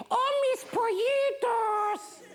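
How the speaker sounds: put-on very high, drawn-out old-lady coo; high-pitched fussing old auntie; doting old woman. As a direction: coo to beloved chickens in a high, slow voice. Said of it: "and she'd say".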